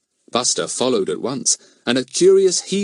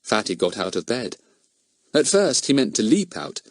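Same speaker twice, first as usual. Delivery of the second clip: ordinary delivery, nothing marked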